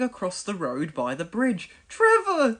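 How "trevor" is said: high, loud call of a name; yelling. A narrator's reading. The twins calling Trevor over, loud yell. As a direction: shout to him across the road. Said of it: "they yelled".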